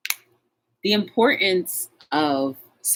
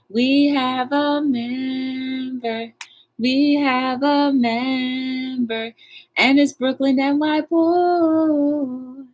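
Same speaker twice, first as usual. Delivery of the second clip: much higher, slow, drawn-out announcement; excited announcement; 11 semitones higher, 3 dB louder, much slower than usual. Real, not acted. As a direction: announce the new member like a prize. Announces new member joining.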